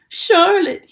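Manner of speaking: high, loud anguished moan. A narrator's reading. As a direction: wail her name in grief. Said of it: "he moaned".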